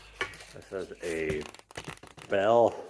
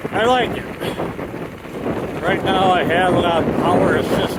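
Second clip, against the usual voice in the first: loud and higher, over the wind on a bike; shouting over wind; 7 semitones higher, 8 dB louder than usual. Real, not acted. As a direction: raise your voice over rushing wind. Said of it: "if you can hear me over the wind".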